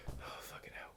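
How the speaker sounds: very soft, whispered curse; exasperated muttering. Real, not acted. Writer told to add a song, whispered exasperation. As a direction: curse under your breath. Groans at being asked for a song.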